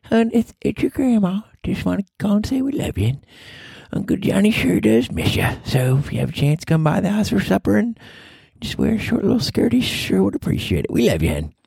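high Southern grandma voice; friend's grandma voice. Acted. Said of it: "a voice that I do where I play her grandma".

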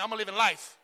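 loud, falling call for the church to echo; call to repeat. Shown as a demonstration. Pastor leads church in a repeated declaration, loud call. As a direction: lead the room to shout it back. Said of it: "Say it with me".

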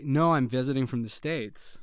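convincing American visitor; confident put-on American accent. Shown as a demonstration. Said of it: "Wow, it is pretty convincing".

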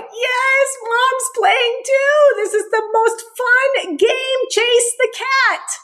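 high, slow, gleeful dog; excited dog voice. Retold or imitated. Dog thinks mom joined the chase, excited high voice. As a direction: squeal it out like a thrilled puppy. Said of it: "The dog is like".

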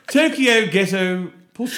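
slow, high, laughing posh Australian. Retold or imitated. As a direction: drawl it like a posh Australian lady. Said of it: "Don't make fun of my well-spoken Australian".

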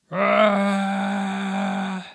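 long, high, loud war cry; battle cry. A narrator's reading. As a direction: roar it as they charge in. Battle cry as men charge.

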